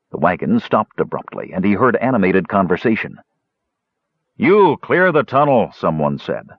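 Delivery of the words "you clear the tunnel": gruff guard voice. A narrator's reading.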